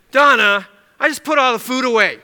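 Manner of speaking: loud, exasperated complaint to a latecomer; put-upon host's acted grumble; grumbling. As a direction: grumble it loudly, with the name hit hard. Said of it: "That would be grumbling".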